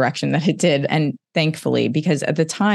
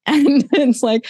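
high laugh breaking into the words, slow; rueful laugh as she goes on; 12 semitones higher, 4 dB louder, slower than usual. Real, not acted. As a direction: laugh it out sheepishly. Laughs at her own upset.

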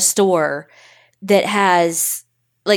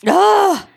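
frustrated groan; 12 semitones higher, 4 dB louder than usual. Real, not acted. Embarrassed after crying on air.

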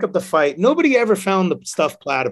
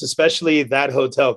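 ordinary talk

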